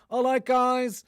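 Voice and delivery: high, far, breezy American "All right, guys"; American vlogger accent. Retold or imitated. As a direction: ape an American influencer greeting in an American accent. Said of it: "the same pronunciation".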